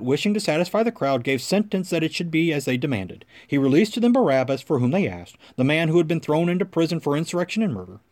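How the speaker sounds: ordinary talk, nothing marked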